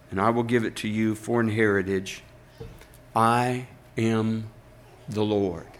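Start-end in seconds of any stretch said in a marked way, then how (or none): none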